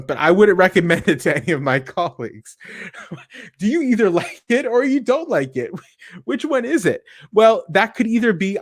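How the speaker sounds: real laughter breaking into the words; laughing. Real, not acted. Speaker laughing to the audience at a mixed review, laughing. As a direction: chuckle through it, amused.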